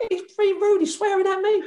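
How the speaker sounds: high falsetto impression of the cook; high falsetto tattling cook. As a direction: tell on him in a squeaky voice. Rita complaining to manager.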